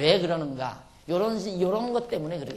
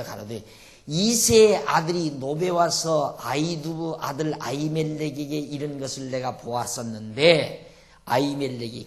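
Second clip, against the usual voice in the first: scripture reading; 6 dB louder than usual. Acted. Preacher reads scripture aloud.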